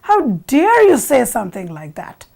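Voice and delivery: mildly acted shocked indignation; alarmed outrage. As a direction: rebuke as if startled awake and affronted. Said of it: "wakes up alarmed and says".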